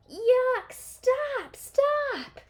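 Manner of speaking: very high, loud yuck-stop squeal; high pleading cry of disgust. A narrator's reading. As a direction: shriek at him to stop. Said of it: "cried Annie".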